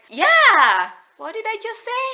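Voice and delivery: high-pitched, incredulous retort; mock exasperation. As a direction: act out miffed disbelief at being corrected. Acted exasperated reply to friend.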